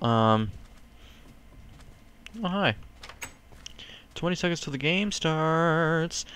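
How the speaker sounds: ordinary talk, nothing marked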